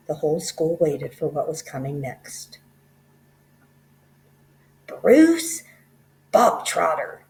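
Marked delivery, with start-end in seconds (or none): 4.9-7.2 loud, high bark of a name. Acted. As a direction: bellow the boy's name across the hall. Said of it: "the Trunchbull barked suddenly".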